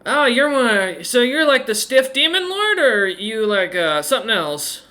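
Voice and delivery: high, swooping teasing voice; playful woman rogue. Acted. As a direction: lilt up high as a cheeky lady rogue.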